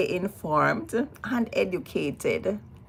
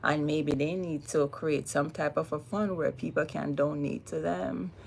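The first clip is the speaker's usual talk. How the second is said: ordinary talk, nothing marked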